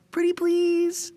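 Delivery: pleading. Acted. Begging a pal for help.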